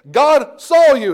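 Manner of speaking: God saw you, slow and loud, leaning on saw; emphatic stress. Acted. Preacher telling the church God saw them, slow and loud. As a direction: hammer home that God saw.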